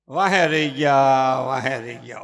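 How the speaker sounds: low, slow wail of regret; hammed-up regret; mock lament. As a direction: moan that they were left behind. Mocking greedy people's regret.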